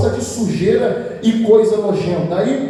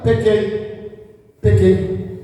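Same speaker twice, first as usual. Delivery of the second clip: private confession; much slower than usual. Acted. Saul confessing in the prophet's ear, grudgingly. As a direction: confess curtly, as if for one ear. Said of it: "Aí ele confessa no ouvido do profeta".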